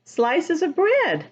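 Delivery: ordinary delivery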